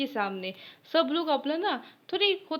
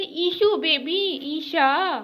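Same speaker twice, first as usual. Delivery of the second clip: cutesy; 5 semitones higher, 4 dB louder, much slower than usual. Retold or imitated. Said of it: "तो बोलते".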